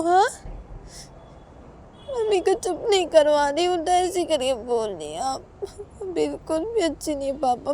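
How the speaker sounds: put-on high child's whine of protest; indignant little daughter. Child telling papa he is bad, indignant whine. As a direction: sulk and accuse in a small child's voice.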